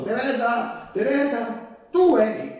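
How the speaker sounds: calling out her name, high and loud; retold shout. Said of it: "ho urlato". (grido acuto e forte)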